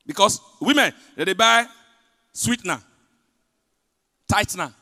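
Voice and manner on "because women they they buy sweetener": ordinary talk, nothing marked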